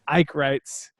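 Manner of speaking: ordinary talk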